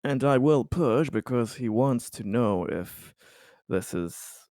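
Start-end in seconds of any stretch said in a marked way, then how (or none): none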